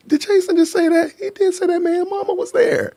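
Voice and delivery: high, squeaky disbelief; can't believe he said it; stunned disbelief. Real, not acted. Host reacting to a viewer's mama joke, in high disbelief.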